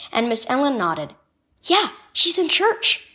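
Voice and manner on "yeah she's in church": ordinary delivery, nothing marked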